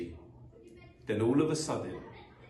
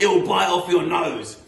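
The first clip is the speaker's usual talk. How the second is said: loud, higher taunting cry; Mister Twit; 7 semitones higher, 11 dB louder than usual. Acted. Mister Twit scaring his wife, loud mock alarm. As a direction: holler the warning to frighten her.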